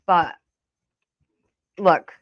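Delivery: ordinary talk, nothing marked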